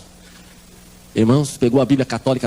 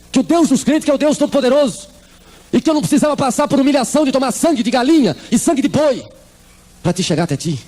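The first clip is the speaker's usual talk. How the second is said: raised pleading voice; 10 semitones higher than usual. Retold or imitated. Mother demanding answers from God.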